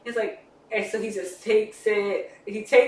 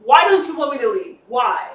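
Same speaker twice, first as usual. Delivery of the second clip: louder, higher demanding questions; exasperated retold challenge; 3 semitones higher, 6 dB louder than usual. Character about to run off, exasperated demand. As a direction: act out demanding an answer.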